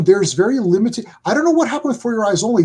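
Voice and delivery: normal talking voice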